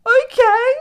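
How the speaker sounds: very high, loud squawk; exaggerated taken-aback reaction; baffled shock. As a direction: imitate a friend's startled disbelief. Friend baffled by abrupt goodnight.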